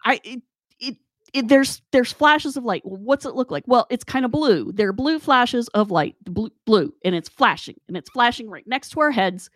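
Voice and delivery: high-pitched, stumbling insistence; exasperated protest. Retold or imitated. Arguing with Gary over blue flashes, exasperated. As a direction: argue frantically with someone who can't see it. Acted argument with disbelieving Gary.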